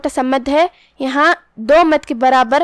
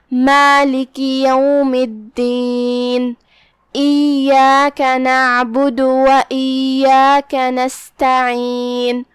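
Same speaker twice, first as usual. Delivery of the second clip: drawn-out, melodic tajweed recitation; measured chanting of scripture; slow Quran recitation; much slower than usual. Shown as a demonstration. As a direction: recite each word slowly, with tajweed. Demonstrates the recited verses.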